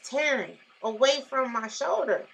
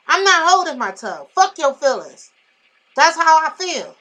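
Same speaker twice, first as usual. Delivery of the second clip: defiant; 4 semitones higher, 7 dB louder than usual. Real, not acted. Defiant rant, loud.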